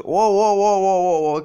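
loud rapid wow wow; panicked shouting. Acted. Kazuma panics at the girl's declaration, loud alarmed wows. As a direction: shout to stop her in alarm. Panicked outcry to calm a girl.

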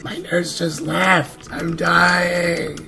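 high, loud, slow hammed-up wail; loud joking lament; mock wailing. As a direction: play it as a melodramatic dying patient. Mock despair at game nurse.

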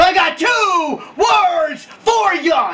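high, slow wrestling-promo shout; drawn-out, hyped-up catchphrase. Acted. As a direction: belt out each word slowly to the crowd. Demonstrating his shouted wrestling catchphrase.

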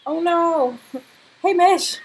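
loud, high cry breaking into laughter; amused, dismayed shout; alarmed outcry. Real, not acted. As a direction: yell at the misbehaving pet. Alarm at pet's mishap.

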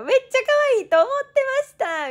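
excited fangirl squeal; loud gushing fan. Retold or imitated. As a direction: squeal the compliment out. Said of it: "でっかい声で言われて".

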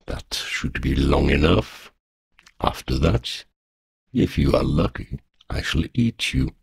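low calm threat, last words breathed; a polite promise to eat her; menacing dragon. A narrator's reading. Dragon tells girl she'll be eaten, low calm menace.

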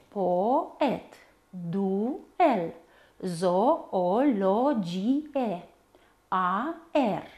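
slow, teacherly syllable-by-syllable reading; despărțit în silabe. Shown as a demonstration. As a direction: pause inside each word where the syllables divide. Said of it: "despărțirea în silabe se face între". (cuvinte rostite rar, pe silabe)

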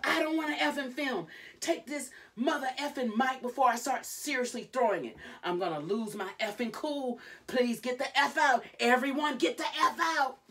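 high, loud sustained shouting; furious meltdown. Retold or imitated. Retelling Jade clearing out the camera crew, furious shouting. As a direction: re-enact losing it at a film crew. Quoting Jade melting down, fists up.

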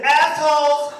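high retold shout-back; defiant call. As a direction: call it over the other person's pleas. Repeats his call over the apology.

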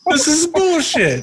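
high, drawn-out comic whine; mock outrage. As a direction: protest in an exaggerated high voice. Playing the outraged coat owner.

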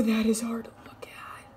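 whispered aside. Real, not acted. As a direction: whisper it in disgust. Reacting to the makeup.

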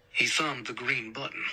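ordinary delivery

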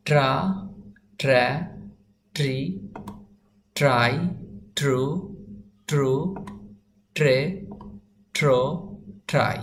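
isolated syllables with long pauses; reading without spelling. Shown as a demonstration. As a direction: drill each syllable singly, pausing after each. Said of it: "তারপরে বানান ছাড়া পড়ব".

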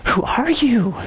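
drawn-out stunned question. Retold or imitated. Preacher retelling his awe at Gina's translation, stunned. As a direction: ask it in disbelief.

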